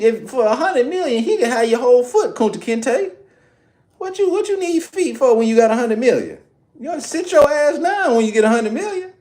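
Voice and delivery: high, scolding mother's voice, well up in pitch; mama chewing out her son over a pinky toe. Retold or imitated. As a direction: play an outraged mom telling her son off. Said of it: "my mama might tell me".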